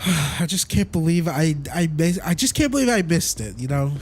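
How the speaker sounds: ordinary delivery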